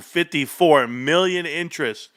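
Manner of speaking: usual speaking voice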